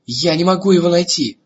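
high, loud desperate cry; desperate shout. Acted. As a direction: cry out in despair. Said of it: "в отчаянии крикнул". (отчаянный крик)

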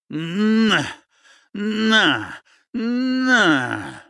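brawler's blows. Acted. Cossack pounding his downed comrade, yelling with each punch. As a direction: put every punch into the word.

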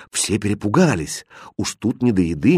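ordinary delivery, nothing marked

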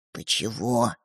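low, hushed question; whispering. A narrator's reading. Second brother questioning his brother secretly, whispering. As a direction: ask softly, under your breath. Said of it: "прошептал Светлик Родьке".

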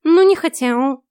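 loud high snort, stress on не; snooty bored cat. A narrator's reading. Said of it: "фыркнул кот".

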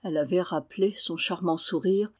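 usual speaking voice

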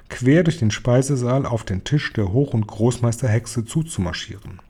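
plain reading voice

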